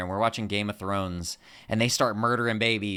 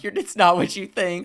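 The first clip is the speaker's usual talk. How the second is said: caught out; 9 semitones higher, 7 dB louder than usual. Pretend. Imagined caught crying.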